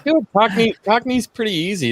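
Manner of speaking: high-pitched mock Cockney; Cockney accent. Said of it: "in a Cockney accent".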